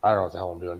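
ordinary delivery

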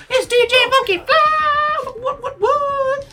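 very high, loud, garbled hype-man shout; slow, stretched party-DJ screech, barely understandable; DJ Funky Fly. Acted. DJ announcing eighties hits to the party, screeched. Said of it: "He sounds a little high".

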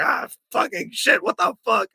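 retold shock at being punched; shocked yelp. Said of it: "I was just like".

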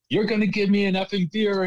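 high, loud mock demand; imitating irate yelling. Bartender retelling an irate customer's demand, yelled in imitation. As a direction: yell it like an irate customer, retold. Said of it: "yelling at me, telling me".

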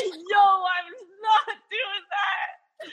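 high, drawn-out mock scream; hammed-up wail of protest; imitated screaming. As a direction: stretch it out high, imitating his screamed refusal. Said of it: "He started screaming".

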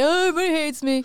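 high, rushed mock complaint; mock whining. Co-host mimics him complaining about replies, mock whining. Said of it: "gonna bitch about it or say".

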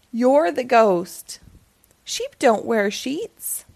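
slow, patient explanation; slow explaining. Acted. A friend tells Wilford he is the ghost, slowly. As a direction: spell it out slowly.

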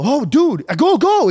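high, urgent exclamation; alarmed yell. Retold or imitated. Yelling at driver stopped mid-intersection, high and urgent. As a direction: raise pitch in exasperated alarm.

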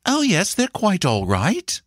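high-pitched governess voice; reassuring governess. A narrator's reading. Governess answers the mother.

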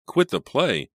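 aghast, pitch shooting up; gasped. A narrator's reading. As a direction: gasp it out in disbelief. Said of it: "Selina gasped".

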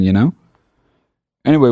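ordinary delivery

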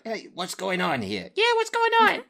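high squeaky tiny critter voices; little spider voices. Acted. Spiders protest as their buddy is taken out, squeaking. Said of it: "two little spider voices".

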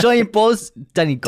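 high, laughing character voice; mocking movie character. Co-host quotes The King to friend, high character voice. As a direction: toss the taunt out high and amused. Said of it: "This is Robert Pattinson's character in The King".

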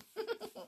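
high light giggle; a quick girlish giggle; narrator's giggle for Anne. Acted. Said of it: "beginning to giggle".

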